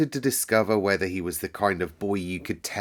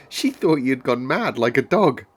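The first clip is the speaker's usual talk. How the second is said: loud, high and animated; lively outburst, pitched up; too admiring; 6 semitones higher, 6 dB louder than usual. A narrator's reading. Girl teasing the sick boy, too excitedly admiring. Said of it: "Not at all, admiringly".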